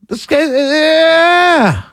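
long, high shout; drawn-out shout. Real, not acted.

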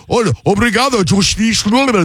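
hammed-up mock Portuguese accent. Host showing caller why he skipped Portuguese, mock Portuguese accent. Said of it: "if I do a Portuguese accent".

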